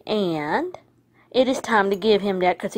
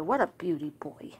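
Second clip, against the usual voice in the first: hushed, very soft whisper; doting whisper; 5 semitones lower, 10 dB quieter than usual. Real, not acted. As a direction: whisper fondly to the dog.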